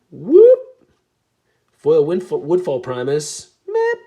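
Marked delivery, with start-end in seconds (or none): 0.1-0.6 high loud whoop; sudden celebratory whoop; excited whoop. Real, not acted. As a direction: let out a big whoop of joy. Celebrating a pull.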